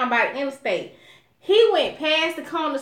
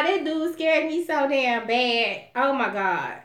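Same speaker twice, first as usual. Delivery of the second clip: ordinary talk, nothing marked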